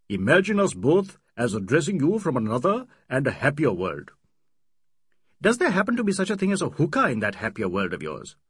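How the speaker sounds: put-on old friends clowning at dinner time; joking friends. Friends' banter voiced in dialogue.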